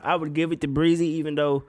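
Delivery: usual speaking voice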